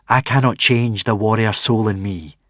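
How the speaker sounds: firm, proud warrior woman; stern warrior mother. Acted. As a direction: speak like a hard warrior queen. Narrator voices Valhalla Rama.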